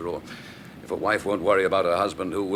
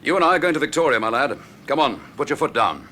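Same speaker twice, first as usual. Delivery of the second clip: louder, pressing the driver to hurry; urgent raised voice; 6 semitones higher, 6 dB louder than usual. Acted. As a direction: call it out over the engine. Urging the driver on in a car.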